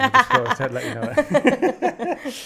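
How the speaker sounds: slow, high, loud laughing aside; drawn-out giggly tease. Real, not acted. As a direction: laugh your way through the joke. Joking that podcast is therapy.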